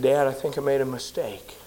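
dejected confession. Retold or imitated. Calling home dejected.

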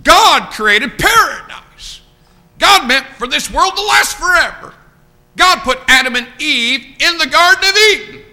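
high, ringing pulpit proclamation; full-force preaching. Real, not acted. Preacher telling the congregation what he told that man, full-force preaching. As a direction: cry out each sentence, then pause.

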